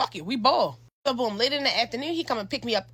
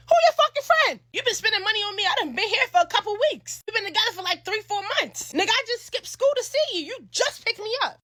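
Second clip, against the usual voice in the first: much higher, angry accusing voice; mock outraged confrontation; 10 semitones higher than usual. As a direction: let the outrage climb. Girlfriend confronts Noah angrily.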